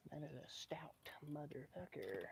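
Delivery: hushed whisper. Real, not acted. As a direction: whisper it to yourself. Admiring the solid wood.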